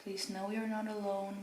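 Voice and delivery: ordinary delivery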